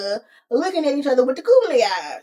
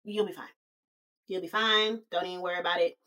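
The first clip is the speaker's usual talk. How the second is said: soft, understated brush-off; low-key reassurance; 5 semitones lower, 8 dB quieter, faster than usual. Acted. Fifi answering her dramatic friend's goodbyes, quietly reassuring. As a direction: play it quiet, pitch sinking at the end. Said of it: "And Fifi is like".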